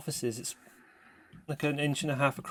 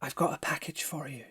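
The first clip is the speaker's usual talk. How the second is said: whisper; 4 dB quieter than usual. Acted. Stranger whispers delivery instruction.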